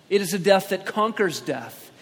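normal talking voice

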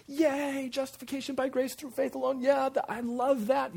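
high-pitched, over-excited cheering; gushing mock enthusiasm. Preacher mimicking a reader delighted by justification by faith, gushing mock enthusiasm. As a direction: cheer it like a giddy fan, way up high. Acted gushing fan of one truth.

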